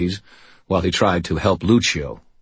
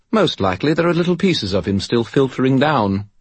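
cheerful, high and chirpy; bright; 7 semitones higher than usual. Acted. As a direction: say it brightly, almost gleeful. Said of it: "Bob said brightly".

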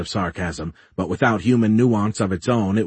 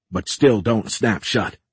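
plain reading voice

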